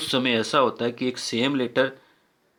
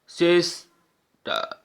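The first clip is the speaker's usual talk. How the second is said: Sister said slowly as सिस, pause, टर; two separate efforts on sister; syllable by syllable; 4 semitones higher, much slower than usual. Shown as a demonstration. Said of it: "टू बार effort करना पड़ रहा है". (Sister को दो हिस्सों में रुककर)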